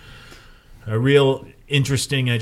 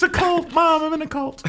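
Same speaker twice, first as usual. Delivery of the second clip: much higher, squeaky mock panic; high panicked squeal. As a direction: squeal it like a scared kid. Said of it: "What have I joined?".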